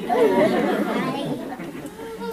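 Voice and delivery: loud high burst of laughter; big laugh. Real, not acted. Laughing after the joke.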